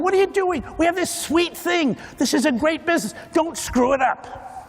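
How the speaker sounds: high, pleading business partners, slowing at the end; mock-anxious partner voice, rising high; worried partners. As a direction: beg them not to wreck a good thing. Partners pleading not to change.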